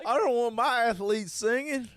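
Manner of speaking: mock outraged sports fan.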